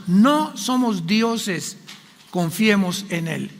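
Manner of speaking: slow, soft and gentle; tender reassurance. Acted. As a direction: comfort the congregation slowly. Tender close of the exhortation. (predicador consolando a los hermanos, lento y suave)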